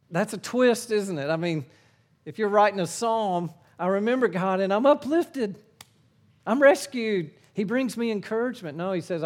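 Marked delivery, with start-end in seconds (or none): 3.8-8.8 mock uplifted. Imagined uplifting psalm version.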